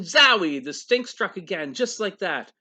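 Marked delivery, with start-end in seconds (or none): 0.0-0.6 loud, higher zowie; startled exclamation. Acted. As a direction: burst out at the renewed stink. Stink strikes again.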